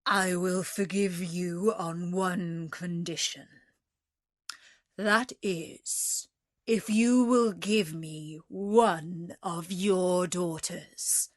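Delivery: slow, measured beast voice. A narrator's reading. Beast offering the merchant forgiveness on one condition, restrained terrible voice. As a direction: lay down the condition slowly and weightily. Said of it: "said in a less terrible voice".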